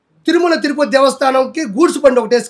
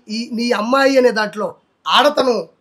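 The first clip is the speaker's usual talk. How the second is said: ordinary delivery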